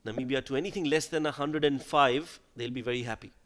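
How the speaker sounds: ordinary talk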